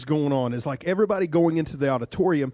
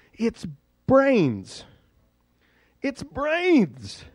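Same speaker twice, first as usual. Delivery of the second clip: high-pitched horrified reveal; hammed-up horror; acted horror; 8 semitones higher, much slower than usual. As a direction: reveal something ghastly, pitch soaring. Acted horror at bin of brains.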